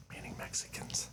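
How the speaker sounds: whisper. Real, not acted. Aside he wants muted.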